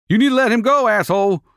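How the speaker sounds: high, taunting jerk. A narrator's reading. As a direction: dare him with a sneer. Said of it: "dared to say".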